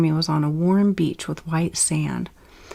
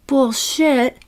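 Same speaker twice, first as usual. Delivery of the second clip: scornful; 7 semitones higher, 4 dB louder than usual. Acted. Lily rejects reassurance.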